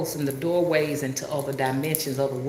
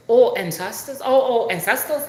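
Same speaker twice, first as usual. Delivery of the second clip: higher, louder mimicked African accent; imitated African accent; 7 semitones higher, 5 dB louder than usual. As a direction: mimic an African man finally catching on. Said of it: "He's from Africa".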